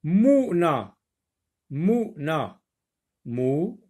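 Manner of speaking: "Mouna" said twice, then "mou" alone; split into syllables. Shown as a demonstration. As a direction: cut "mouna" so the "mou" stands apart. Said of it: "entre U et N".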